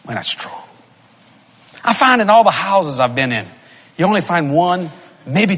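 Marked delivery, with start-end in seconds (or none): 0.1-0.6 breathy stage whisper of confession. Real, not acted. Preacher to the men, whispering the admission. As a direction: drop to a whisper, admitting it.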